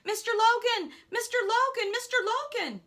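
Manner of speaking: high, loud urgent shouts; yelling. Acted. Boy runs down the hill after golf partner, yelling. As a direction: shout the name after someone in danger.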